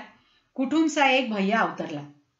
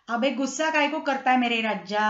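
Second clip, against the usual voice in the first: plain reading voice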